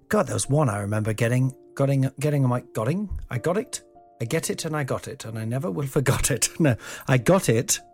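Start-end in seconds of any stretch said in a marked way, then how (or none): none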